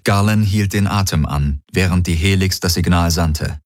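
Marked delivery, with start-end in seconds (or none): none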